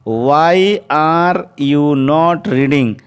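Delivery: very slow, word by word, clearly spaced; slow dictation. Shown as a demonstration. Teacher dictating to the class, very slow and clear. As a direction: spell it out slowly for students to write. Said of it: "teacher dictates the English sentence".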